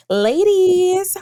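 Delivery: high, loud, drawn-out hail; excited greeting. Acted. Fan greeting the two podcast hosts, excited and drawn out. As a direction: call out to the hosts like a gushing fan. Reads a fan's gushing greeting.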